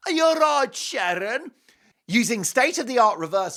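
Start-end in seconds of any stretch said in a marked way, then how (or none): 0.1-1.5 high-pitched rock-star impression.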